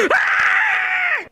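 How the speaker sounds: high-pitched panicked scream. Acted. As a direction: shriek in sudden terror. Luigi finds himself all alone.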